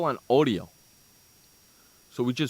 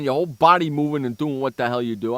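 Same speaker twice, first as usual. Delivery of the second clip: ordinary talk, nothing marked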